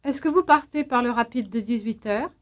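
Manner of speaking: ordinary delivery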